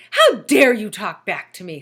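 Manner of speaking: loud indignant snap; outraged at being answered back; snapped. A narrator's reading. Teacher snapping at Todd, loud indignant.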